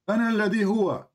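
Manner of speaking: "أنا الذي هو" as a native Arabic phrase. Shown as a demonstration. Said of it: "I say it in Arabic".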